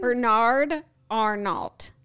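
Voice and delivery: "Bernard Arnault" slowly, as two separate words; slow, deliberate "Bernard ... Arnault"; careful repetition. Shown as a demonstration. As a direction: spell it out by ear, pausing between "Bernard" and "Arnault". Says name slowly when asked to repeat.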